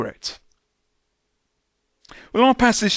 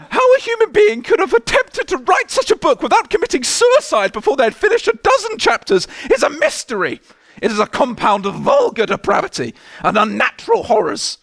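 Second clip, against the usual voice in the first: much higher, hammed-up Victorian English lady accent; mock posh English accent; 10 semitones higher than usual. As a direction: read the review as a prim English lady. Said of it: "No, I will".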